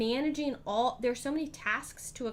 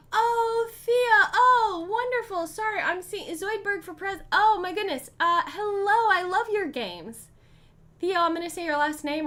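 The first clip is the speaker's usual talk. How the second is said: much higher and louder, gasping with delight; starstruck squeal; 10 semitones higher, 8 dB louder than usual. Real, not acted. Streamer squealing as a game designer raids her channel. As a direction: gush like meeting your hero.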